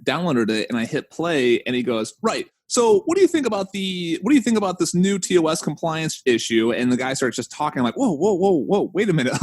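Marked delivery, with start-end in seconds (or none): 2.2-6.7 high, put-on British voice that dives straight in; imitating British host. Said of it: "you can hear his accent". 7.9-9.4 mock startled. Retold startled reaction to podcast.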